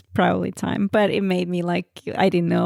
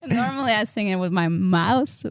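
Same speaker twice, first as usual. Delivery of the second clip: usual speaking voice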